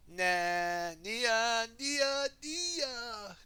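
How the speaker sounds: high, loud, drawn-out call of her name; crying out. A narrator's reading. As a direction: call out desperately, stretching each name. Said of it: "Kai cried".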